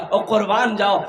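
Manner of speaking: normal talking voice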